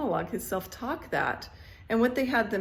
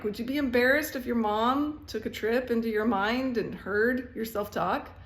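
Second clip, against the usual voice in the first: ordinary delivery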